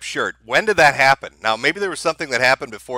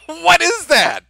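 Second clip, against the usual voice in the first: high, incredulous laugh; 4 dB louder, slower than usual. Real, not acted. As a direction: laugh out the question. Laughing at the clip.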